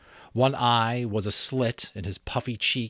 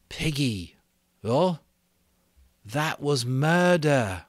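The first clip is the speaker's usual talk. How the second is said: put-on slow, heavy boy's voice; stricken Ralph; 6 semitones higher, 4 dB louder, slower than usual. Ralph confessing to Piggy, slow and heavy. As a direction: let the guilt weigh each word.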